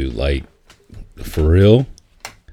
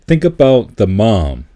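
normal talking voice

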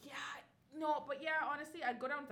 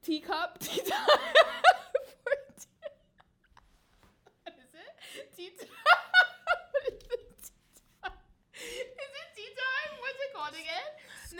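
higher, giggling through the words; laughing; 11 semitones higher, 12 dB louder than usual. Real, not acted. Hosts laughing while trying to recall the show name. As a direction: laugh as you fumble for the name. Forgets her own podcast name.

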